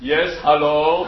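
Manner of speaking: put-on slow, low Croatian accent; unfazed, drawn-out Croatian-accented reply; Goran Ivanisevic. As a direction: answer slowly in a deep Croatian accent. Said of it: "I used to particularly love his accent".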